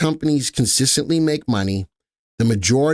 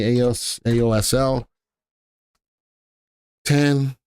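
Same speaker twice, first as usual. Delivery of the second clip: ordinary talk, nothing marked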